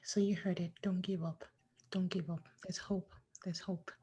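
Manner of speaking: very soft, near-whispered encouragement; hushed reassurance. Real, not acted. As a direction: gently echo the message, barely voiced. Host consoles after guest cries.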